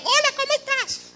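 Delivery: high, light everyday hello; sweet high hello; mock casual greeting. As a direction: chirp a friendly normal greeting way up high. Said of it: "normalmente te hablan".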